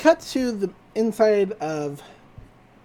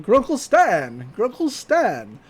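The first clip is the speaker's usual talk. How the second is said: calling out; 4 semitones higher, slower than usual. Retold or imitated. Dipper calling out, searching town.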